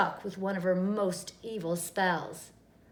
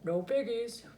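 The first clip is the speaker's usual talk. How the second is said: farmer voice. A narrator's reading. Farmer's reply.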